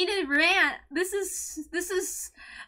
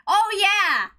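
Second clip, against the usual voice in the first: ordinary talk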